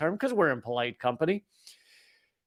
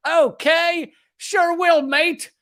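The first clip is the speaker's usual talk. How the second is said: much higher, louder, cheerfully mock-obedient; mock German guy agreeing; 12 semitones higher, 6 dB louder, much slower than usual. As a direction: play a German guy chirping yes, mate. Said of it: "I don't know why I got a German guy saying".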